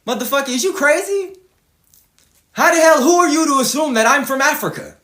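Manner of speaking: mock-offended rant; retold indignation. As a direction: play it insulted and incredulous. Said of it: "I said".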